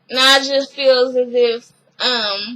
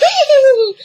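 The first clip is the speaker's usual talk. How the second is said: loud, high-pitched cackle; high squealed laugh; 12 semitones higher, 5 dB louder than usual. Acted. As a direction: burst out in a shrill laughing squeal. Acted reaction after "you're like.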